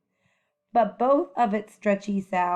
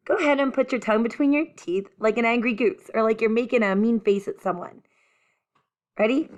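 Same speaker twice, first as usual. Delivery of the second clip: quick aside; much faster than usual. Real, not acted. Teacher instructing, hurried aside.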